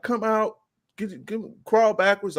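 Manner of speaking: high-pitched commanding call; acted police order. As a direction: imitate police calling out orders.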